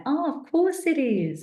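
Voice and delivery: high, slow, drawn-out dawning; a playful, knowing of course; mock realization. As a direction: stretch it out as the penny drops. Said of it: "And I'm like".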